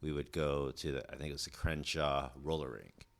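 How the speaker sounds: ordinary delivery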